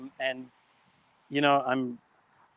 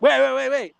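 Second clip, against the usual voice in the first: urgent pleading; 6 semitones higher, 5 dB louder than usual. Acted. Desperate buyer stops him.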